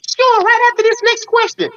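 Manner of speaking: irritating, squeaky-high imitation; high-pitched counselor. Counselor putting off a requested break, mimicked high-pitched. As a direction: mimic her in a very high falsetto. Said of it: "one of the high-pitched voices".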